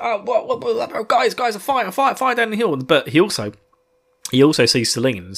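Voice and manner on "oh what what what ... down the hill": high, urgent fire call; breathless alarm. Retold or imitated. Hurin bursting into camp about the fire, high urgent. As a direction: run in babbling the news. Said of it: "he's like".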